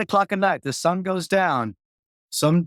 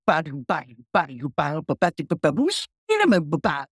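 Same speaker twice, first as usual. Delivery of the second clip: clavinet riff scatted as "ba-do" syllables. Retold or imitated. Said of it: "Sly starts going".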